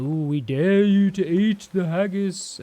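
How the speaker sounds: put-on slow, sing-song taunting kids; mock teasing kids daring a friend; teasing dare. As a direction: dangle the dare like a cheeky camp kid. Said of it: "they're like".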